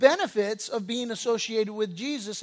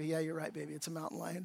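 hushed resigned agreement; 5 semitones lower, 14 dB quieter, faster than usual. Retold or imitated. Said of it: "I go".

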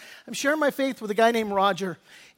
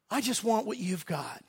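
soft heartfelt plea; 2 semitones lower, 9 dB quieter than usual. Retold or imitated. Roger's heartfelt request in car.

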